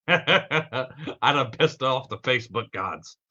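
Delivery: slow, laughing through the words; real amused laugh at the glitch. Real, not acted. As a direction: chuckle while admitting the blunder.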